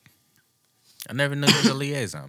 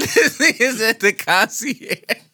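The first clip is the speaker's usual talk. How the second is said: laughing; 8 semitones higher, 4 dB louder than usual. Real, not acted. Laughing at concierge joke.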